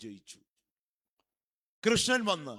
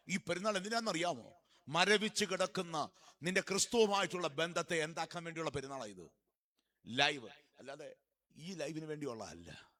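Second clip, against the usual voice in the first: ordinary delivery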